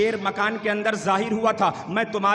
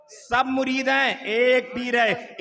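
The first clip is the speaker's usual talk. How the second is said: slow emphatic; 2 semitones higher, much slower than usual. Acted. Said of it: "repeats slowly for weight".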